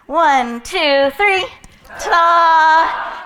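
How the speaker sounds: loud counting up to a ta-da; rallying ta-da count. Shown as a demonstration. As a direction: lead the crowd into the reveal. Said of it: "let's try it all together now".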